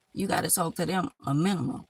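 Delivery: ordinary talk, nothing marked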